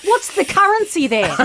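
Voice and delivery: high, eager question about the currency; lightly voiced eager teenager; imitating curious kid. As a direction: act like a fascinated kid. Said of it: "They're like".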